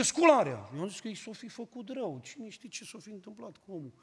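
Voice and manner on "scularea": a loud retold "Scularea!" bark; old man's wake-up shout. Old man rousing the household to pray, loud call. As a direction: call the house awake at three. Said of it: "Avea o voce mai tare ca mine".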